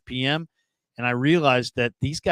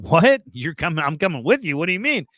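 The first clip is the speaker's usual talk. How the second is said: incredulous questions, acting out his younger self caught off guard; retold disbelief; 4 semitones higher than usual. Scout recalling his reply to coach's invitation, startled disbelief. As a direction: replay a startled, higher-pitched "what?".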